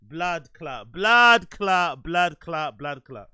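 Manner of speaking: blood clot yelled then fading; mock shout. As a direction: shout it, then let it die away. Repeated exclamation, middle shouted.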